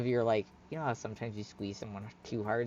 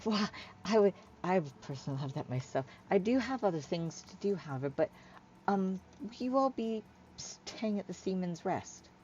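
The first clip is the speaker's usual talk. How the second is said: higher, hesitant, lightly voiced figure; awkward polite Hyla; 4 semitones higher, 7 dB quieter than usual. Hyla arranging to meet her shipmates, awkwardly polite. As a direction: politely fumble through the arrangements.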